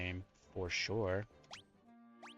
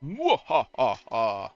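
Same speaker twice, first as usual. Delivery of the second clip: evil laugh; 8 dB louder, much slower than usual. Acted. Villain laugh while reading dialogue.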